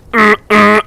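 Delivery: two loud, high honking blasts; imitated truck horn. Truck driver honks for the kids, imitated horn blasts.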